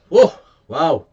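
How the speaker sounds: sudden loud whoa, wow; startled wow. Real, not acted. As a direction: caught off guard by the strength. Reacting to powerful whisky.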